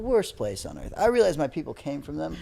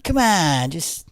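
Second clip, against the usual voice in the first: Biden; 3 dB louder, slower than usual. Retold or imitated. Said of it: "The Mexican Biden".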